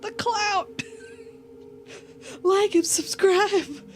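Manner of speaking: high-pitched comic cry for subscribers; mock desperate plea. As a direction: plead loudly as if about to be mauled. Mock plea while lion attacks.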